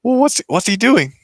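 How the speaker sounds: high, loud fussy sidekick; Blake. Acted. Sidekick in the background questions the villain, high and loud. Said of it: "Blake is in the background".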